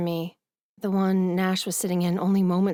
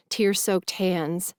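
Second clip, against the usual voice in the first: plain reading voice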